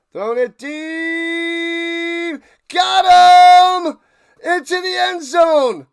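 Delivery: high, loud, stretched-out touchdown yell; excited touchdown call. Real, not acted. Streamer calling a touchdown for chat, shouting high and loud. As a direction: scream the touchdown like a hyped announcer.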